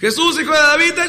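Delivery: high, loud desperate plea; crying out. Acted. Blind beggar cries out to Jesus, loud and pleading. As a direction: call out to someone far off for mercy. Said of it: "Y empezó a gritar".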